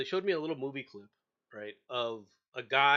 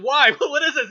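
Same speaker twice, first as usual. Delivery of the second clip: loud, high alarmed why; startled recruit yelling; 12 semitones higher, 15 dB louder than usual. Retold or imitated. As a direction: cry out in disbelief, half laughing.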